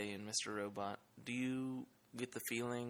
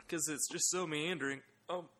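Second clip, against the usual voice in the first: higher-pitched mock exasperation; mock choked-up; 7 semitones higher than usual. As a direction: play at being overcome. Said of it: "Really impassioned about that".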